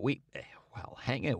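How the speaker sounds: whispered, fumbling aside; embarrassed whisper. Acted. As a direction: breathe it out awkwardly. Man hesitates, embarrassed.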